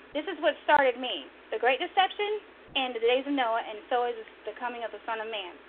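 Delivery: normal talking voice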